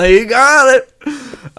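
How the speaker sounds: slow, loud, drawn-out You got it; excited praise. Retold or imitated. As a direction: cheer him on slowly and loudly. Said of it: "I would've been like".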